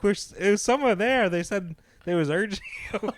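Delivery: laughing. Retold or imitated. Retelling prank reply, laughing.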